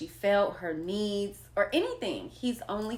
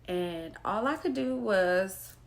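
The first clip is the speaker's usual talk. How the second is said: usual speaking voice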